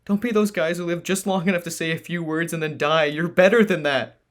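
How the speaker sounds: high, loud tearful plea; crying out. Acted. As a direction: cry out in grief. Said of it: "the Sentinel cried".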